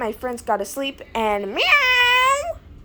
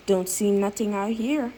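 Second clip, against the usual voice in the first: ordinary talk, nothing marked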